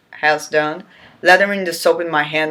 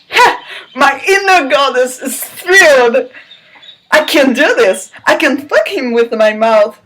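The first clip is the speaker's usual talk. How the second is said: high, louder mock glee; hammed-up thrill; 6 semitones higher, 5 dB louder than usual. Reader mocking the heroine's glee, high and loud. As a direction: overplay the giddy excitement.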